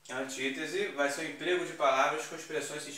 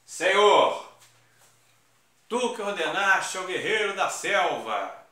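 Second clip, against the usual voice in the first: loud, high exclaimed address to the Lord; declaiming invocation; 6 semitones higher, 9 dB louder than usual. Shown as a demonstration. Teacher declaiming the apostrophe example loudly to students. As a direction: proclaim it to the heavens. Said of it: "invocação de alguém".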